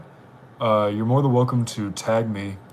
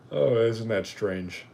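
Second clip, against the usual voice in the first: usual speaking voice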